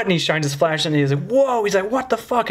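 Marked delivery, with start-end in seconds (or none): 1.3-1.9 high-pitched astonished whoa; shocked guard. Retold or imitated. Guard shines flashlight on the mess, shocked. 1.9-2.5 high, incredulous imitation; shocked guard. Guard reacting to the mess in the car, shocked.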